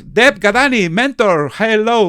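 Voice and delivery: loud, high-pitched hail; calling out. Real, not acted. Host hailing a mentor to join in, calling out. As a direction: call out to get their attention.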